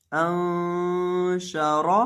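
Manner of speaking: अंशा chanted very slowly with a nasal hum on the noon; drawn-out recitation. Shown as a demonstration. Teacher reciting the joined syllables for students, slow chanted ghunna. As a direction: hold the noon of अंशा in a long sung ghunna.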